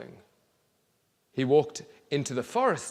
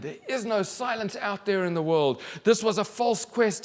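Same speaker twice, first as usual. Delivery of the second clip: high, loud frustrated complaint; 9 semitones higher, 4 dB louder than usual. Acted. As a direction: vent it in frustration. Boy angry at world.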